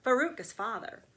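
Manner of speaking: ordinary delivery, nothing marked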